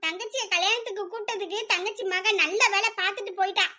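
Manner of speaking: usual speaking voice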